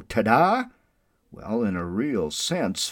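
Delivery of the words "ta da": put-on kid's ta-da. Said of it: "we'd go".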